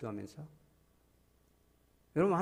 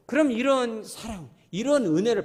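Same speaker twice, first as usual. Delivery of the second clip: slow and loud, higher; loud preaching; 8 semitones higher, 8 dB louder than usual. Acted. Preacher calling out to congregation, loud and slow. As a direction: proclaim it slowly.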